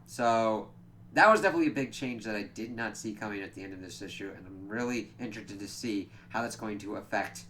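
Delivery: usual speaking voice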